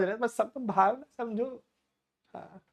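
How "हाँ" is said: ordinary delivery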